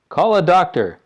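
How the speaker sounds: loud urgent father; urgent shout. A narrator's reading. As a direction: shout for help. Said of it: "shouted Mister Beauregard".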